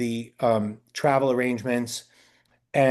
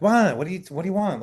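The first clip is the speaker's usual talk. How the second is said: imitating a curt actor, higher-pitched; abrupt; 6 semitones higher than usual. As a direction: snap it back as if bothered. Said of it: "it was just very abrupt".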